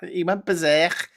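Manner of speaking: high, loud Scouse exclamation; Liverpool accent. Shown as a demonstration. Relishing the word berserk, Liverpool accent. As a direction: say it in Scouse, with a throaty end on berserk. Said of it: "especially in a Liverpool accent".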